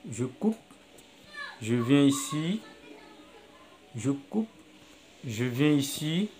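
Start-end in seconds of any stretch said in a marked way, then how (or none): none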